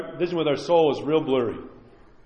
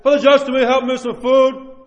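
higher, loud call for help; interrupting plea; 5 semitones higher, 6 dB louder than usual. Retold or imitated. Homeless person interrupts the priest mid-talk, interrupting plea. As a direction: break in loudly, imitating someone asking for help. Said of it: "homeless person will kinda interrupt and say".

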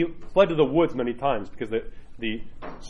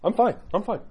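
high-pitched, brief brush-off; mock defensiveness; 8 semitones higher than usual. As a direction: act out someone defending themselves automatically. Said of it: "trained ourselves to just defend everything".

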